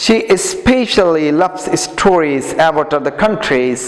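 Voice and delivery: slow, careful English read-aloud for pupils. Shown as a demonstration. Teacher reading slowly to the class. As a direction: read each word slowly so students copy. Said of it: "উচ্চারণগুলো খেয়াল করবা".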